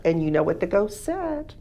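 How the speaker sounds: plain reading voice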